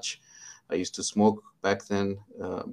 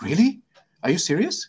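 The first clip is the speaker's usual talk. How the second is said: high, louder mock disbelief; incredulous; 9 semitones higher, 6 dB louder than usual. Senior student reacting to his sudden grin, incredulous. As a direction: act out a startled, disbelieving question.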